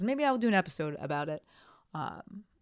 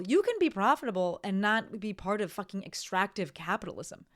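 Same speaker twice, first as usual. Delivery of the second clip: usual speaking voice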